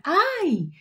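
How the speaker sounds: put-on sudden high child's wail of pain; a little girl yelps and starts crying. Daughter on the stool suddenly cries out, high wail. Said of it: "Y empieza a llorar".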